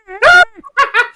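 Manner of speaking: high, loud mock cackle; witch cackle. Imitating a politician seizing power, witch cackle. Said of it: "cackle like, you know, like a, like a witch".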